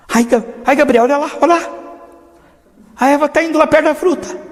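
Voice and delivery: high, loud fretful cry of alarm; panicky God. Acted. God frets to Gabriel, high and alarmed. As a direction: fret aloud as Eve nears the fruit.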